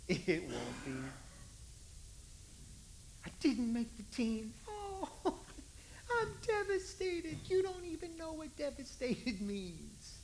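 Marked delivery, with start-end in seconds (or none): 4.7-10.2 high, half-crying mock sob; mock sobbing. Teen cut from the team, mock sobbing. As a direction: fake a teen's tearful wail.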